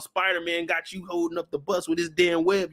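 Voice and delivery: ordinary talk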